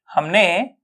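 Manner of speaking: ordinary talk, nothing marked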